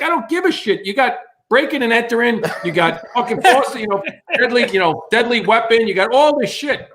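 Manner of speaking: high-pitched exasperated scolding; acted tirade. Cop reading the intruder the riot act, acted exasperation. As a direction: rattle off charges as an incensed cop.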